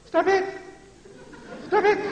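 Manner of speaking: very high, squeaky little plea; mocking chicken voice. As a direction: parody a feeble scolding in falsetto. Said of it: "that chicken voice".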